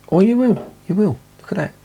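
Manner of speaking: high-pitched jump of surprise; sudden high squeal of correction; pleasantly surprised. Real, not acted. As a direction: pipe up high, suddenly proved wrong. Spots bubbles after doubting camera shows them.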